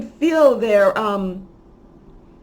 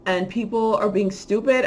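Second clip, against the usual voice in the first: ordinary delivery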